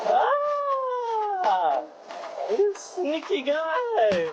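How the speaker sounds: high, slow startled cry. Real, not acted. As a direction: yelp at the sneaky crab. Crab slips or pinches.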